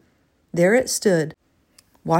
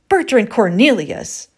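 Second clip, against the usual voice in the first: loud, slow, outraged full name; indignant wife, each name punched out; scolding; 3 semitones higher, 5 dB louder than usual. A narrator's reading. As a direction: scold your husband by his full name.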